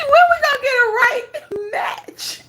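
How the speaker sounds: fast, high, shouted through laughter; laughing mock wail. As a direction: cry out in laughing imitation. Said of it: "Then she".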